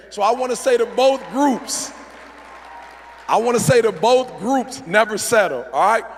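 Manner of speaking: loud, emphatic speaker working the room; motivational preaching. Real, not acted. Speaker urging both groups never to settle, forceful motivational preaching. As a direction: punch it out with rising conviction.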